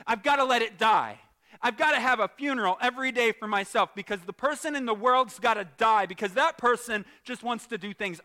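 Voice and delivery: punchy declarations in a rising preacher's voice; fervent preaching. Real, not acted. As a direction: preach it with force to the whole room. Preaches on dying to self.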